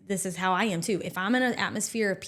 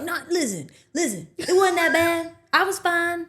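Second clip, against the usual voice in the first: higher, exaggerated mock protest; imagined husband voice; 9 semitones higher, 4 dB louder than usual. Friend imitating husband retelling the story, mock protest. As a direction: put on a silly defensive husband. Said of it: "That is not even how he talks".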